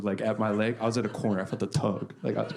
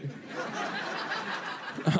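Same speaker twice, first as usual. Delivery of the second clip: breathy high laugh; 6 dB quieter than usual. Real, not acted. Laughs at own joke.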